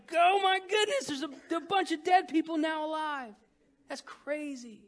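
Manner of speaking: preacher's high amazed wonder. Real, not acted. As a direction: marvel aloud at it. Amazed at the dead rising.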